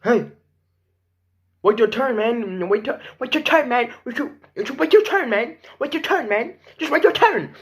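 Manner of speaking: put-on high, pushy kid voice; whiny kid voice. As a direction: nag like a bossy kid. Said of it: "You dude come pushing me like".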